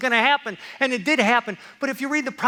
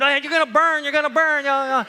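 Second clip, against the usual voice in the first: higher, louder nagging refrain; mock prophetic warning; 5 semitones higher, 5 dB louder than usual. Preacher resuming the prophets' warnings after an aside, mock urgent. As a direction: jump back into mock doom warnings.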